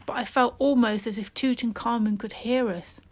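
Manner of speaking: ordinary delivery, nothing marked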